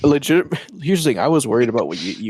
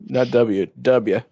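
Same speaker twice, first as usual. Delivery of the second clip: louder drawn-out W like Bush; a hint of George W. Bush saying W; mock George W. Bush; 3 dB louder, much slower than usual. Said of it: "he talks like that".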